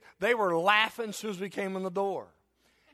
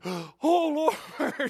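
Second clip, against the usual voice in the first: higher, louder dismayed cry; horrified oh Lord; 5 semitones higher, 3 dB louder than usual. Retold or imitated. Pastor seeing the text went to the wrong man, dismayed cry.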